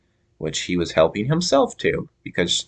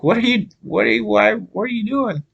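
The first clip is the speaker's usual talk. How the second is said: much higher, mock-incredulous questioning; baffled questioning. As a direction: question a cousin's weird stunt in a high voice. Acting out bafflement at cousin's weird lie.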